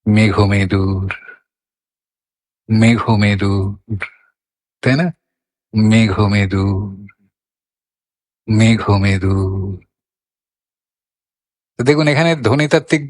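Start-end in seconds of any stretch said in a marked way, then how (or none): 0.1-4.0 felt, cloudy mood. Shown as a demonstration. Teacher feeling out an overcast day, slow and moody. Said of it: "মেঘমেদুরের অনুভবটা যদি করি". 5.7-9.9 slow, low-key মেঘমেদুর with a long last syllable; felt, cloudy mood. Shown as a demonstration. Teacher feeling out an overcast day, slow and moody. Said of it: "মেঘমেদুরের অনুভবটা যদি করি".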